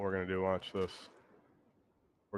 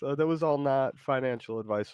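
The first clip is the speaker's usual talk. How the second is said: usual speaking voice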